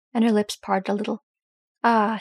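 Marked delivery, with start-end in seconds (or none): none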